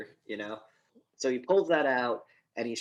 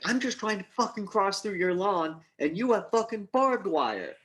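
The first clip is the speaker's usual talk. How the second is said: high, exasperated protest; mock outrage of a movie character; acted indignant rant; 8 semitones higher, 3 dB louder than usual. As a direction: rant as the wronged trespasser, pitched well up. Acting out same barbed-wire rant.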